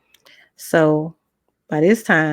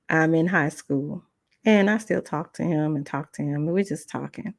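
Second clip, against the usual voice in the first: ordinary talk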